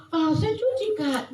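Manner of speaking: high-pitched imitation of a girl; light, high retort; girl's protest. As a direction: brush off the warning in a high child's voice. Girl answers back, retold.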